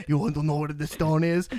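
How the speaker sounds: low-pitched, badly faked German accent; terrible German accent. Red Skull quizzes Thanos about the stone, terrible German accent. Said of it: "Which is a terrible German accent".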